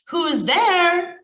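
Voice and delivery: put-on a wolf putting on an old lady voice; grandma voice. Wolf pretends to be grandma answering the knock. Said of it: "in a voice like Grandma's".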